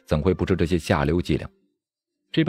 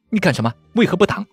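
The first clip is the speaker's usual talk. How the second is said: high, loud outburst of alarm and anger; shocked angry shout; 12 semitones higher, 5 dB louder, faster than usual. A narrator's reading. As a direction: shout in furious disbelief, pitch leaping up. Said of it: "胡梅尔惊怒交加， 喝道". (又惊又怒地高声喝问)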